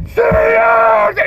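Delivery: very high, drawn-out shriek; imitated prisoner's piercing scream; screaming bloody murder. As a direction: scream for the guard as if being killed. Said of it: "this guy is screaming bloody murder".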